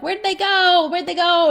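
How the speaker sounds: high, loud playful searching call; pretend searching. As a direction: play-act hunting for a hidden kid. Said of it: "pretend they're invisible and you're like".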